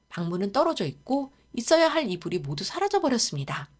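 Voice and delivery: usual speaking voice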